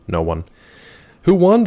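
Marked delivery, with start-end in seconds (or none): 1.2-1.6 high, loud who won with stress on "won"; mock outrage. Streamer repeating who won even louder. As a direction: push the question harder, louder.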